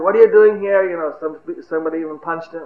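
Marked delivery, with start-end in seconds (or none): 0.0-0.9 loud, higher-pitched demand; rough challenge. Acted. Mob roughing up the watchman, loud accusing challenge. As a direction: confront him hard, acted. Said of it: "they started roughing".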